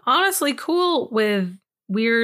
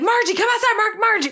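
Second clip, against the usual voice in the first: retold panicked yell; 10 semitones higher, faster than usual. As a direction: call her outside in a panic. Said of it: "I started screaming and freaking out".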